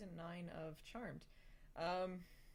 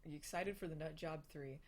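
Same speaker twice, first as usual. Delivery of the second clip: usual speaking voice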